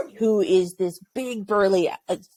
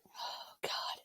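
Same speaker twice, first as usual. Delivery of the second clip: much softer whispered sigh of relief; 21 dB quieter than usual. Retold or imitated. Alone by the helicopter after the jets leave, whispered. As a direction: breathe it out under your breath.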